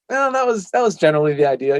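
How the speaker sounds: self-rating quip. Retold or imitated. Voices his self-rating.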